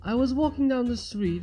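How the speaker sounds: plain reading voice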